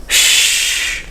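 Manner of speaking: a soft hissed shh; shushing. Shown as a demonstration. Teacher showing how to quiet someone, shushing. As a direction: hush someone gently. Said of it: "When we want someone to be quiet".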